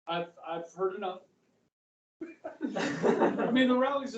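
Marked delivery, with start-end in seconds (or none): 2.2-4.2 laughing. Real, not acted.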